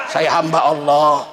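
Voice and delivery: low, timid reply with a laugh; a lightly voiced sheepish sinner; meek answer. Sinner answers the angel on judgment day, low and meek.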